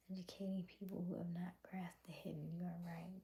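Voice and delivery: quick soft whisper. Real, not acted. Whispering a viewer's comment back to the chat. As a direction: hush it, quickly.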